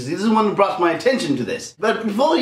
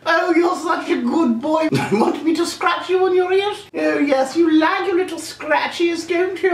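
evil queen voice; 11 semitones higher than usual. Pretend. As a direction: lord it over them from the throne, much higher. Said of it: "like the queen".

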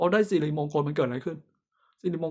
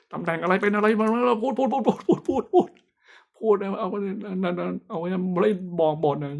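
higher, louder mock nonstop chatter with a laugh; rapid-fire talking demo; 5 semitones higher, 5 dB louder than usual. Shown as a demonstration. Fortune teller shows clients how fast he reads, rattling nonstop. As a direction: rattle off words without stopping. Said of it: "พูดเร็วมาก ไม่ได้พูดช้าๆ".

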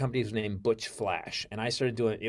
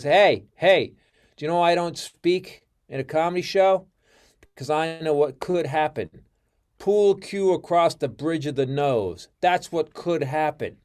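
louder, higher mock threat to the crowd; imitating angry heckle; 5 semitones higher, 7 dB louder than usual. Comic threatening chatty crowd at a Christmas party, loud and menacing. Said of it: "And [name] just goes".

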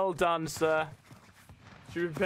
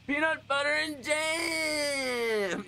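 drawn-out greeting shout; 8 semitones higher, much slower than usual. Real, not acted. Stream opener, drawn-out shout.

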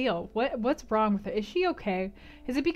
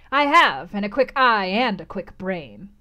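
louder proud crone, slowing at the end; 7 dB louder than usual. Acted. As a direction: brag each quick thing.

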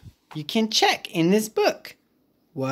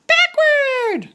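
high little pig call; Piggy calling the move to Gerald, high voice. Acted.

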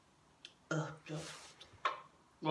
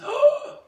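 gasp; 6 dB louder than usual. Real, not acted. Shocked by the answer.

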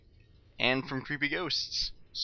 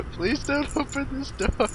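higher, pleading; begging in fear; desperate high plea; 12 semitones higher than usual. Real, not acted. Hiding from janitor, pleading.

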